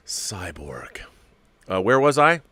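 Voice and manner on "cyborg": soft unvoiced "Cyborg"; whispered name. Real, not acted. Repeating the figure's name to himself in a whisper. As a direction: breathe the name reverently.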